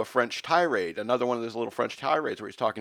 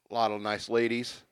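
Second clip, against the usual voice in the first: ordinary talk, nothing marked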